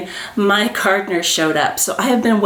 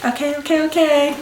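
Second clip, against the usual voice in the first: giddy excitement; 7 semitones higher than usual. Real, not acted. Composing herself after seeing bag.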